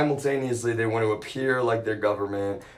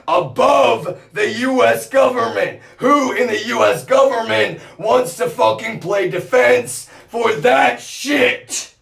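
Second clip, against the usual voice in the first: shouted much higher and louder, slowing at the end; furious shout; 11 semitones higher, 7 dB louder than usual. Real, not acted. Raging at officials who defend them, furious shout. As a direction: yell it in outrage.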